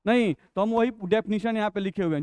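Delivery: usual speaking voice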